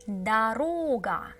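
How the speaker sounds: slowed, clear repetition; slow model word. Shown as a demonstration. Teacher repeating the word slowly for learners. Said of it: "We see that this O is stressed".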